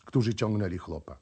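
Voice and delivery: ordinary delivery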